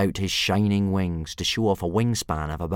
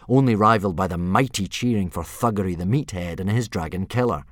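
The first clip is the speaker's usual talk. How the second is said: usual speaking voice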